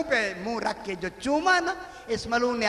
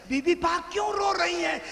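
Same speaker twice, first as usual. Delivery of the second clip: higher, urgent cry of worry; alarmed question; 7 semitones higher than usual. Acted. Umm Ayman runs to Bibi Fatima, alarmed. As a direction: rush in and ask in alarm.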